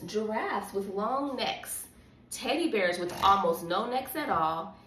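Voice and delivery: ordinary delivery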